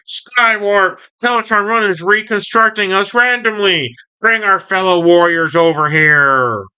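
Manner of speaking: high, hammed-up villain command; Starscream. Starscream ordering Skywarp from the ground, high mock command. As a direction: pitch it high like a urgent cartoon villain. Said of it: "Starscream's, like, on the ground".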